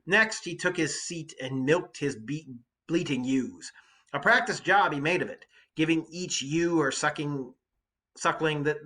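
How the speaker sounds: loud, lively read-aloud of the epic; dramatic reading. Acted. Teacher reading the Odyssey to students, dramatic read-aloud. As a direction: read the poem to the class with energy.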